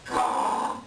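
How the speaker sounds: harsh breathy bird caw; a rough crow-like call; raven caw. Acted. Raven calls out at Coyote.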